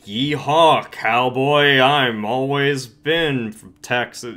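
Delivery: mock cowboy whoop, slow at the end; poser Texan. Mocking a poser newcomer, mock Texan drawl. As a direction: ham up a fake Texas drawl. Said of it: "I'm not gonna automatically be like".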